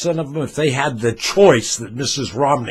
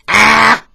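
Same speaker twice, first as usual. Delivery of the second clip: demo scream; 9 semitones higher, 10 dB louder than usual. Shown as a demonstration. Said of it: "If your nose is broken while you're screaming".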